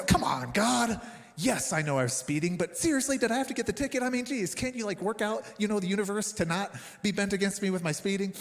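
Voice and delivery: mock complaint to God. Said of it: "Did you have those moments?".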